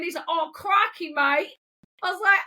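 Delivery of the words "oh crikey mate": higher-pitched hammed-up Aussie greeting; imitating someone doing Australian; mock Australian accent. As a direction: put on a cartoonish Australian accent. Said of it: "mistaking me for an Australian".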